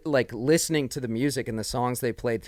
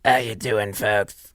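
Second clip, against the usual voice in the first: worn-out voice; 4 semitones lower, slower than usual. Retold or imitated. Mocking a worn-out voice.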